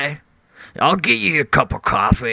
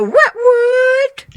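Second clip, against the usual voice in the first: sudden high squeak on both words; squeaky catchphrase; 4 dB louder than usual. Acted. Odd guest squeaking his catchphrase at the host, high. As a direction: yelp it up high like a cartoon.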